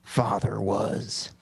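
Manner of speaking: slow, low, halting villain impression; reluctant Joker, each word dragged out. Cohost gives in to the host's request, slow Joker impression. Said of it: "let me hear your Joker impression".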